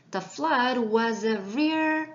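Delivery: very slow, careful English; slow model reading. Shown as a demonstration. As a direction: read it slowly for the class. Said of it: "읽어볼까요".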